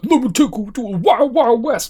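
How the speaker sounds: high, fast put-on Dre voice; Dre impression. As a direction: rattle it off like a hammy Dre. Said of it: "and then Dre's like".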